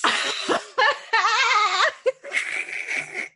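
high helpless burst of laughter; real laughing fit. Real, not acted. Host cracks up at her sick co-host, helpless laughter.

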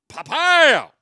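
loud high shout standing in for a crash. Retold or imitated. Bookshelf hits the classroom floor, huge loud crash yell. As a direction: blast it out like a bookshelf slamming down. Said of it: "Huge noise".

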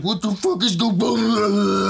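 high, slowed question; confused little voice. Acted. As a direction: ask it high and bewildered. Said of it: "he's like".

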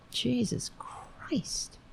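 slow, breathless whispered curse; exasperated whisper. Real, not acted. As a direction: whisper it under your breath, fed up. Muttering while hunting for software.

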